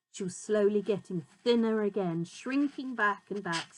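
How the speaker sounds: ordinary delivery, nothing marked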